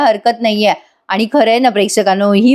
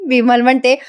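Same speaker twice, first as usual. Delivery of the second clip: normal talking voice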